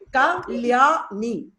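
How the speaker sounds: KALYANI slowly as ka, lya, ni; syllable by syllable. Shown as a demonstration. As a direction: spell out KALYANI by sound, three long beats. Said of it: "my name has three syllables".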